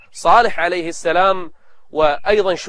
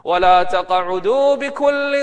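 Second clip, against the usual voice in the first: higher, slow melodic chant; higher Quran recitation; 5 semitones higher, much slower than usual. Acted. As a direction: chant it, lifting the pitch.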